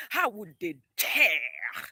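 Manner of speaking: loud, drawn-out outrage; slow it right down and blast the indignation; indignant outburst. Real, not acted. Indignant at claim of racism.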